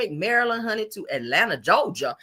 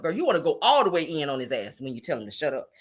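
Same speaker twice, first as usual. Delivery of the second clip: normal talking voice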